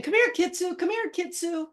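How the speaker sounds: high-pitched retold call to a dog; coaxing call. Owner walking towards a loose dog, coaxing high call. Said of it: "I started to walk towards Kitsu".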